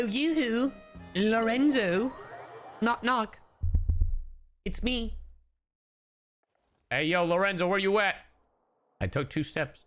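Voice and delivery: ordinary talk, nothing marked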